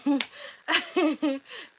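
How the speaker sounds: teasing high laugh; bright laugh. Real, not acted. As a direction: burst out laughing, high and loud. Teasing a viewer about school.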